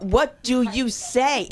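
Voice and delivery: loud, high, drawn-out start, then racing on; exasperated outburst. Acted. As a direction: belt it out in outrage. Outraged bit after offensive remark.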